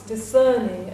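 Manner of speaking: ordinary talk, nothing marked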